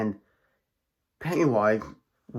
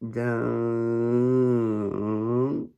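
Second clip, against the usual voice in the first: long, held slide on the word; drawn-out word. Shown as a demonstration. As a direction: stretch it into one long sustained hum. Acts out the strand dropping.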